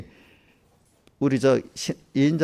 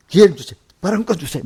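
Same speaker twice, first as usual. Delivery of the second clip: loud, high insistent pleading; mock eager demand to speak; pleading for the floor; 7 semitones higher, 7 dB louder, faster than usual. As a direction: push loudly to be heard.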